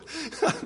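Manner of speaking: quick amused laugh at himself; a brief real chuckle; short laugh. Real, not acted. Preacher laughing at his tofu slip to the congregation, short laugh.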